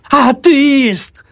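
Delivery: very high, drawn-out shriek; screeching old woman. A narrator's reading. Thrashing old woman denounces the doctor, screeching. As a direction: screech it as a denunciation. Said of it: "верещала".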